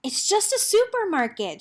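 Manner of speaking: high, relieved announcement; announcing. A narrator's reading. Girl announces to her dog, high and relieved. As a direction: tell her the news brightly. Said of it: "she announced".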